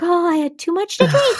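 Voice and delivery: high, light dismissal; fake-cheerful excuse. Podcaster imitating Miranda's breezy excuse, high. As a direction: brush it off brightly. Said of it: "she's like".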